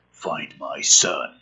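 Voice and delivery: normal talking voice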